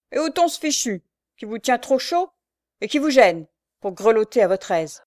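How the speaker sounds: sarcastic orders; mocking bossiness. A narrator's reading. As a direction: needle her with false solicitude. Shrew mockingly strips girl's shawl.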